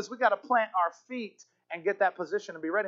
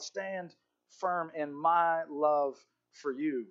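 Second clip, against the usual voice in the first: ordinary delivery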